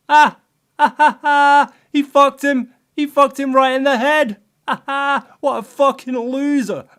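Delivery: high, loud jeering cackle and yell; a rowdy backbencher mocking at the top of his voice; heckler shouting. Backbench heckler jeering at humiliated Set, loud mocking shout. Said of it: "someone from the backbenches was shouting out".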